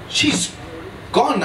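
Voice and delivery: mild hesitant stammer, broken by a pause; a touch of flustered hesitation. A narrator's reading. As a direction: falter before the last word. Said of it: "I stammered".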